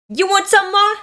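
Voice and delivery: high, loud challenge; taunting scream. Acted. Reader acting out a manga fight, high taunting scream. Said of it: "I can scream however much I want".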